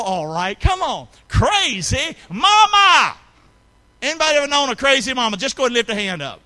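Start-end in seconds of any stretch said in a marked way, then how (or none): 1.3-3.2 slow, loud, high drawn-out shout; broad singsong kids theatre. Acted. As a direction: stretch it out and shout it to the crowd. Shouted slow title line.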